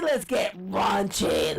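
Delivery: slow, loud drawn-out call; drawn-out shout. Real, not acted. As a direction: stretch it out and shout. Shouting to get raunchy.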